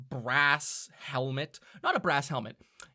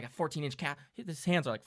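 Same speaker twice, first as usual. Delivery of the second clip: ordinary talk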